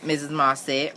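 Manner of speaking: ordinary delivery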